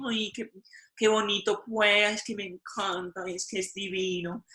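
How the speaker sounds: high, sing-song Medellín Spanish lilt; Medellín accent. Retold or imitated. Teacher imitates the sing-song Medellín accent, high and lilting. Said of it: "it's very sing-songy".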